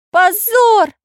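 very high, loud exclamation; piping outrage; indignant. A narrator's reading. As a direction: cry out scandalized in a high voice. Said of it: "возмутился Карандаш".

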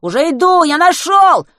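loud urgent calling; shouting. A narrator's reading. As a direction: yell across the distance. Shouting back to friend.

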